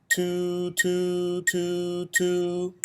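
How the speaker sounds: "Two, two, two, two" in one breath, each note tongued apart; one breath, tongue each "two" separately. Shown as a demonstration. Band teacher demonstrating articulation: each "two" tongued in one breath. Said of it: "All in one breath".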